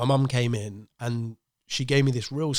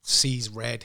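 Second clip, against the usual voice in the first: ordinary delivery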